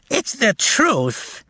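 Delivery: put-on high, slow, thin child voice; old man shouting in a childlike voice. A narrator's reading. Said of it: "in a thin, childlike voice".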